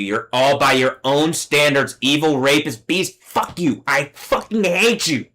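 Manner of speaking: angry rant rising to a high-pitched outburst; furious outburst. Real, not acted. Ranting at everyone they hate, real fury breaking out. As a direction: build into real rage, pitch climbing.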